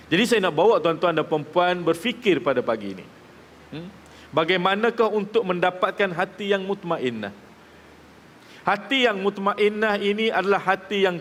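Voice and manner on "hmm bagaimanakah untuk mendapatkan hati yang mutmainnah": ordinary delivery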